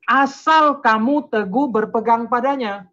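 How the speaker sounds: loud, high, slow and deliberate; preacher hammering home a verse; emphatic scripture reading. Real, not acted. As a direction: proclaim each word of the condition. Drives home the verse's condition.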